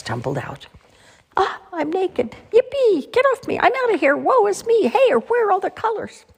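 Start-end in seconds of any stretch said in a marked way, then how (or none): 1.4-6.2 very high, excited little character voices; excited crayons. A narrator's reading. Crayons tumbling out of their box, high excited cries. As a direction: squeal out each exclamation, high and loud.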